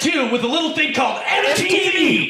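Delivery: high, shouted movie rant; mock rant. Imitating teacher ranting to kids about the man, high mock shout. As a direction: build it into a shouted tirade, imitating him. Quotes Dewey's rant at the class.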